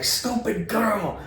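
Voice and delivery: very slow, high mock grumble; drawn-out accented mock muttering; exasperated insult. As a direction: stretch the words out in imitated exasperation. Man grumbles after hanging up.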